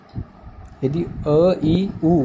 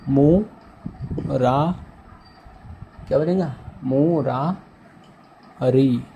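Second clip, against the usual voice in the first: मु रा री split into slow separate beats; syllable by syllable; slower than usual. Shown as a demonstration. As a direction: spell it out slowly for students. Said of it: "आप बनाओ".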